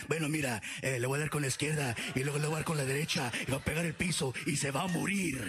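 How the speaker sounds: low, tough fighter in Spanish; hardcore, cool and bad, rattled off low; Canelo. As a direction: deliver it low and menacing, like a tough boxer. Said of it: "Canelo sounded hardcore and cool and bad".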